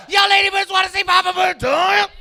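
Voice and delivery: loud, high shout to pump up the room; rally the crowd, big and loud; hyped-up crowd call. Acted. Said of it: "We may as well all be excited about it".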